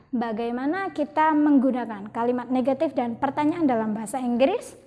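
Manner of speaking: usual speaking voice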